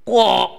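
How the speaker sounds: loud, abrupt raven squawk; big cartoon bird call; raven caw. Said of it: "said the raven".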